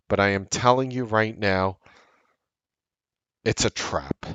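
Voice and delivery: usual speaking voice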